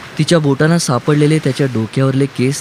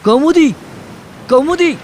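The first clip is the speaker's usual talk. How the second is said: screaming. A narrator's reading. Man groping along the boat for Kaumudi, screaming. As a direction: scream the name out across the water. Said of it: "किंचाळत हात उंचावून".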